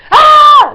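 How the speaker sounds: short, very loud high shriek; piercing fangirl scream; defiant scream. Retold or imitated. Screamer answering a disapproving neighbour at the gig, defiant high scream. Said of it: "just carried on screaming".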